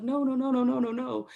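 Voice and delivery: high, rapid run of retold protests; tearful hurried denial. Crying recruit reassuring her panicked mother, high hurried denial. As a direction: re-enact waving off mom's alarm in a high voice.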